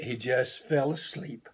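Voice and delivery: ordinary delivery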